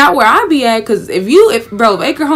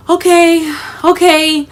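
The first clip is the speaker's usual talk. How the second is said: higher, louder nervous okays; tense repeated okays, pitched up; panicked reassurance; 7 semitones higher, 4 dB louder, slower than usual. Retold or imitated. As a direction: talk yourself through the panic. Driving through deep water, panicking.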